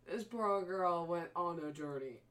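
usual speaking voice

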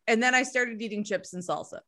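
ordinary delivery

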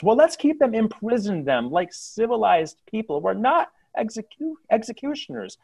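arguing civilian. Acted. Civilian argues for prison.

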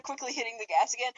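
ordinary talk, nothing marked

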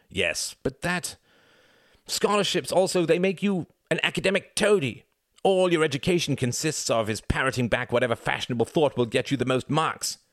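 loud, high, haughty son; arrogant young son. Acted. Arrogant son lecturing his father, loud and high. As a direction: sneer down at everyone. Reginald's contemptuous rant.

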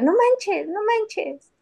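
put-on high, loud kid's no manches; mock the child's catchphrase, high; imitating child. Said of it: "tú eras". (imitación aguda de niña)

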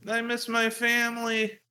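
put-on high, slow whimpering little-kid voice; hammed-up wail of a homesick child; mock crying kid. Him as a kid begging to go home, hammed-up whimper. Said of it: "I was like".

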